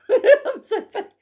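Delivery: high, loud laugh through the words; laughing at herself. Real, not acted. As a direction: crack up at your own joke.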